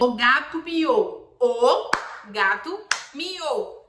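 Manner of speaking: slow, loud "o gato miou", one beat per word; clapped phrase. Shown as a demonstration. Mother clapping words for her son, slow and loud. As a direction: clap each word out. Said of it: "Pra cada palavra, uma palma".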